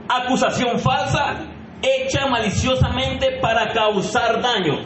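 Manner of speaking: slowed, deliberate definition; slow reading. Shown as a demonstration. Preacher slowly reading the definition to the women. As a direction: lay out each word slowly. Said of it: "te lo voy a leer en cámara lenta".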